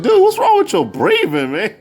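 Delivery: high mock-exasperated questioning; retold exasperated challenge. Fan asking Big about his breathing, exasperated. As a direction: blurt the complaint, voice leaping up.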